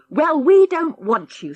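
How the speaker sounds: put-on a boy snaps at his rival, fierce; fierce retort. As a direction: spit it at the sissy boy. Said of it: "said Harry fiercely".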